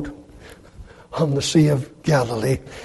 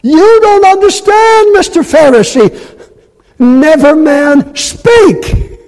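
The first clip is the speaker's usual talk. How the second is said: high, loud, awestruck plea; dazed officers; 12 semitones higher, 8 dB louder than usual. Acted. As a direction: stammer it out in wonder. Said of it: "The dazed and bewildered officers replied".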